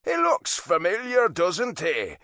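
teasing Irish father; dry Irish pub owner ribbing his son; Irish dad. A narrator's reading. Dad's voice in story.

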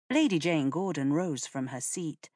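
ordinary delivery, nothing marked

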